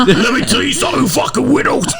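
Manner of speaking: high, strained mobster-boss impression; Hollywood Tony voice. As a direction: squeal it like a sleazy Russian boss. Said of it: "Hollywood Tony impression".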